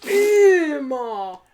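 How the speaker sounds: very loud, high, drawn-out Scottish wail; Count Dankula. Acted. Showing a real Scottish accent, loud wailing Scottish impression. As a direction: bellow it in exaggerated Scottish. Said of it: "Yeah, he goes".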